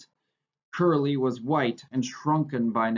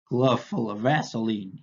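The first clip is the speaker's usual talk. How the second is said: a mild note of disgust; understated contempt. A narrator's reading. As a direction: sneer it lightly with distaste. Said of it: "he said disgustedly".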